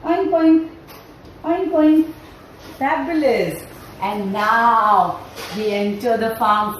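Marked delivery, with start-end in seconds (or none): 0.0-2.1 little piglet oinks, higher-pitched; tiny playful piglet voice; small piglet sound. Shown as a demonstration. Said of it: "you do small, small sound".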